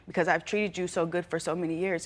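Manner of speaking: ordinary delivery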